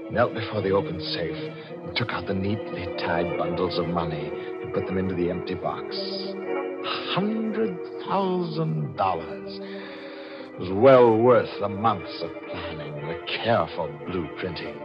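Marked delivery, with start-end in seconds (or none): 6.0-9.6 very drawn-out, high, hushed awe; gloating relish. A narrator's reading. Killer counts his stolen fortune, gloating slowly. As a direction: savour each word slowly, soft and high.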